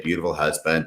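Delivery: usual speaking voice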